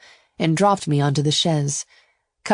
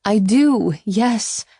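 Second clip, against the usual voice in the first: pressed admission; 5 semitones higher, 3 dB louder, much slower than usual. A narrator's reading. Ava admits it under pressure.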